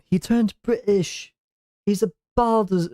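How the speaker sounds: put-on British accent. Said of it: "do a bad British accent".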